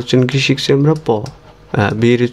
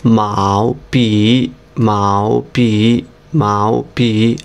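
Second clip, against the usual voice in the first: মাও পি stretched very slowly; very slow drill; much slower than usual. Shown as a demonstration. Teacher slowly drilling মাও পি for students. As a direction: draw out মাও পি syllable by syllable. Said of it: "slow modeling drill".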